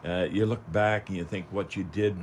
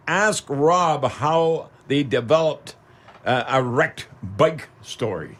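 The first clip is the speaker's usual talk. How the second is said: slow, deliberate read from notes; slow read of a listener note; reading notes aloud; 3 semitones higher than usual. Acted. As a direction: read it out slowly like a card.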